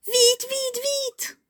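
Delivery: very high cartoon squeal of urgency; urgent panic. Fleeing the miams in the tunnel. (cri de panique très aigu)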